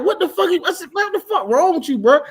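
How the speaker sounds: high-pitched mock disbelief; retold incredulity. Man questions the woman who threatened him, incredulous. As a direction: act out being baffled by her.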